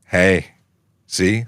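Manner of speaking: usual speaking voice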